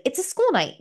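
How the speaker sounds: high, lightly voiced nagging parent; mock scolding parent. Parent scolding a kid about a school night, lightly mocked high. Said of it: "It's like".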